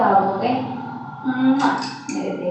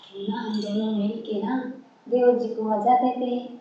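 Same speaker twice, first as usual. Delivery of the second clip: softer, suggestive undertone; confiding proposal; 7 dB quieter than usual. Acted. Sister-in-law making a sly proposal to younger woman, hushed. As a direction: slip it in as a secret.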